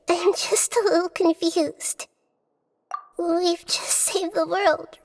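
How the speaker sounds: ordinary talk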